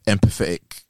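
ordinary delivery

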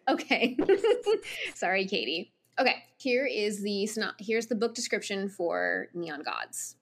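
ordinary talk

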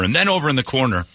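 ordinary talk, nothing marked

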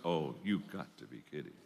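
low, quiet groan of disapproval; negative barber. Acted. Pessimistic barber to his customer about the hotel, dismayed. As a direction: grumble it low and soft, as a doom-laden barber. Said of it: "person full of doubts and fears and negativism".

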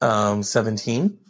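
low far podcast host voice; deep announcer voice. Pretend.

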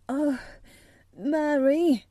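half-sobbing cry. A narrator's reading. Colin overwhelmed by the garden news, half sobbing. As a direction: cry out, on the edge of a sob. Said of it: "cried out with a half sob".

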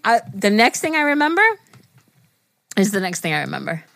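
ordinary delivery

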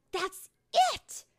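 high, loud burst of realization; storybook fairy's eureka; excited cry. A narrator's reading. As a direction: cry out as the idea strikes. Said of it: "she cried".

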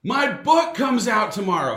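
loud, high and thrilled; excited shout. Real, not acted. Dad correcting his son about the book, excited shout. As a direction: proudly blurt out the news.

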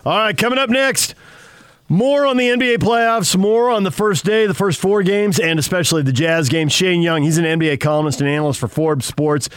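high, bright host tease; radio announcer. Acted. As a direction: hype the next segment like a radio host. Radio host teases the next segment.

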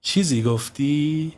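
slow, sly and probing; sly question. Acted. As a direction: ask it slowly, like you're fishing for a slip. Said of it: "ساسان موزیانه پرسید". (پرسیدن آهسته و موذیانه)

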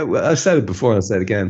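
normal talking voice

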